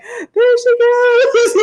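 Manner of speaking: high, slow, laughing marvel; amazed laughing quote. Retold or imitated. Retelling Nicole outpacing her, high slow laugh. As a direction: watch her shoot off like a rocket. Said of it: "And I'm like".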